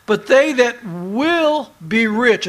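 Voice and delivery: "will" drawn out slow and weighty; stress on "will". Shown as a demonstration. Preacher explains the key to a verse, leaning hard on "will". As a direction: underline the word "will" with a long, strong stress. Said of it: "you need to underline that word will".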